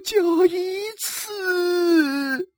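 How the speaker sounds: very slow, high tearful wail; sobbing. Acted. Wife admits it to her husband, sobbing. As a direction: stretch it out into a sob. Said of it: "呜呜地说".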